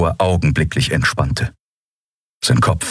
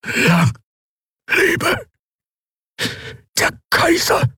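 breathless, unvoiced, very high gasp; last breath; slower than usual. Dying prisoner hails the emperor, voiceless last gasp. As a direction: spend the last air on it. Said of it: "Dann brachte er hervor".

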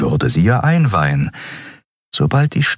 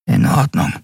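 higher, thick-throated reply; husky, choked-up voice; 8 semitones higher than usual. A narrator's reading. Reluctant friend at the switch agrees, voice thick. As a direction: agree with a clogged voice. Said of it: "sagte sie mit belegter Stimme".

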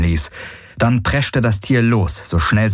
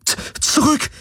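stammering in fear. Acted. Terrified woman threatening with laser.

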